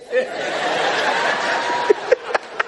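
very high, breathless wheezing laugh. Real, not acted. Preacher laughs at his wife's new name, high and breathless. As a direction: laugh helplessly at the story.

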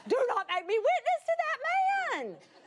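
high-pitched, dramatic pleading; hammed-up begging; desperate protest. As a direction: plead against an unwanted order. Acted plea to God.

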